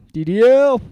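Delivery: high, loud barroom holler; mock rowdy shout. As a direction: holler it like a riled-up bar patron. Irish guy shouts the name.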